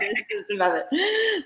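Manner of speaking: high, laughing Muppet squeak; a quick laughing Muppet impression. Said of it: "Jennifer's spiritual voice is a Muppet".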